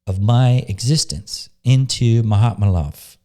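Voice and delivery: slow, deliberate affirmation with drawn-out words; measured meditation mantra with long pauses; spoken affirmation. Shown as a demonstration. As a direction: recite it slowly, phrase by phrase.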